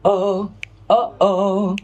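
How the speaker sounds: "Oh" with each start clicked in the throat; separate, firm-edged "oh" sounds; glottal onset. Shown as a demonstration. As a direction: close the vocal folds before each "oh". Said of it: "vocal folds meet before the sound comes".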